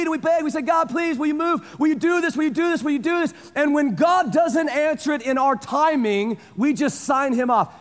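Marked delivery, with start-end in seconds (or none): 0.6-3.3 higher, begging repeated asks; pleading with God in a raised voice; pleading prayer. Retold or imitated. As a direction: beg God again and again. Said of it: "we plead, and we beg".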